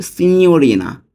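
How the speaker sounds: সিনিয়রিনা slow and high, with a clear "nyo" glide; pronunciation demo. Shown as a demonstration. Teacher showing learners the pronunciation, slow, high "si-nyo-ri-na" for সিনিয়রিনা. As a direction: model সিনিয়রিনা slowly as "si-nyo-ri-na". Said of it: "উচ্চারণটা কিন্তু এইভাবে হবে".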